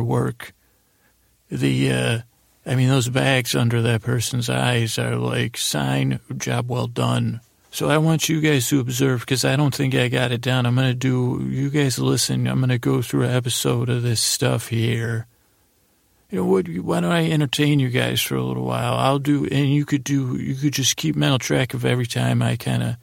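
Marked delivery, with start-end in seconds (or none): none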